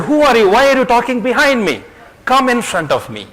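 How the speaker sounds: storyteller acting an annoyed child; loud challenge, easing at the end; a little angry. A narrator's reading. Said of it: "the child was a little angry".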